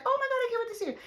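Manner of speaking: breathless fake crush swoon; mock gushing. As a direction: gush like a giddy lover, hammed-up. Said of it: "They're just not like that".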